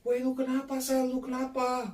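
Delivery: very high mock yell at ordinary volume; retold alarmed scream. Girl finds her friend acting strangely, retold alarmed cry. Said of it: "langsung teriak".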